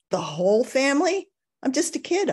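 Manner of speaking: put-on a kid protesting in shock; childish disbelief.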